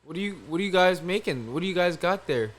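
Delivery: a hint of a breezy party guest asking.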